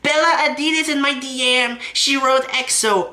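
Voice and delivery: high, giddy show-off; mock giddy brag, high and excited; mocking bragging voice. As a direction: squeal it like a boastful fan. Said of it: "he's like".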